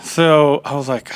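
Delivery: ordinary talk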